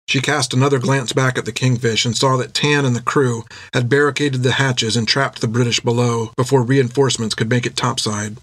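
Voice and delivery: usual speaking voice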